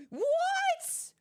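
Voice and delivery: a sudden high, loud cry of surprise; shocked what. Real, not acted. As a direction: blurt it out in total disbelief. Shocked reaction to reveal.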